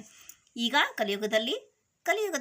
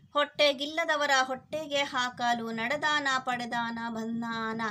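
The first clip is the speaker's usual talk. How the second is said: higher-pitched, rhythmic verse chant with drawn-out line endings; poem recitation; 4 semitones higher, 4 dB louder than usual. Acted. Teacher reads the bear-dance poem to children, sing-song recitation. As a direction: chant the verse, stretching each closing word.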